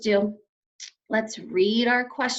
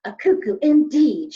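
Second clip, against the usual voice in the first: louder, indignant retort; angry; 3 semitones higher, 9 dB louder than usual. A narrator's reading. Missus Lambchop angrily rebukes the policeman. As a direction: throw the word back at them. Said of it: "she turned angrily to the policeman".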